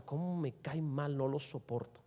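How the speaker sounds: low, soft, slow grudge; softly voiced rancor; quiet resentment. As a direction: mutter it slowly with held-in bitterness.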